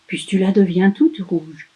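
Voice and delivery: usual speaking voice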